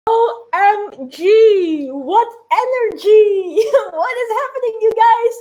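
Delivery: high, drawn-out giddy squeal; excited gush. Real, not acted. Host back on her crashed livestream, high excited gush. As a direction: burst back in overwhelmed with delight. Rejoining stream after crash, gushing.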